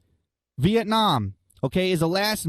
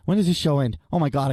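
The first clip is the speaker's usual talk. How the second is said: ordinary delivery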